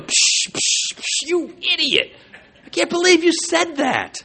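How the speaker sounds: mock himself like a sneering critic; scornful inner critic. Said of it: "Me, I'm going".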